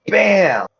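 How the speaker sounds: shouted punchy exclamation; loud bam. Real, not acted. Cheering a hit.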